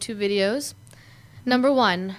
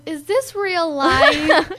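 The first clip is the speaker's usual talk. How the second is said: put-on loopy kid; 5 semitones higher, 5 dB louder, much slower than usual. Kid quoting loopy David.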